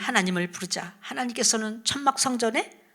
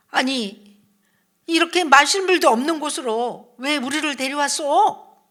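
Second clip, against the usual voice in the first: raised, higher-pitched storybook complaint; loud angry protest; 5 semitones higher, 7 dB louder than usual. Acted. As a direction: call it out as an indignant crowd, acted. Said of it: "한결같이 큰 소리로 말했어요".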